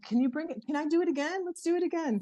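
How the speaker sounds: put-on the kid pleading to do it again; imitating her daughter begging. Daughter voiced in a retold story.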